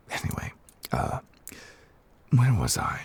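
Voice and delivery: breathy under-the-breath aside; whisper. Real, not acted. Losing count of the Mora, whispered to himself. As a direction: murmur to yourself as you lose your place.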